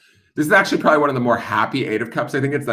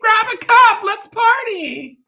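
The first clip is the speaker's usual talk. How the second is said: put-on high party girl. Card woman calling Stacy to party, high girly voice. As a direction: call out like a girl starting a party. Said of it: "They're like".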